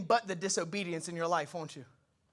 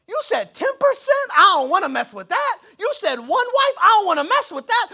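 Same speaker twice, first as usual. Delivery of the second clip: rising indignant complaint, louder and higher; whiny demand; 7 semitones higher, 7 dB louder than usual. Pretend. Congregant whining at God about tithing and gossip, mock indignant. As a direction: protest each command like a spoiled child.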